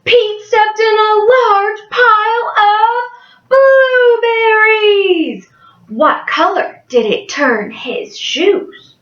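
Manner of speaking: bright storytime voice for a class; cheerful picture book reading; reading to children. Acted. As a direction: read it like a kindergarten teacher.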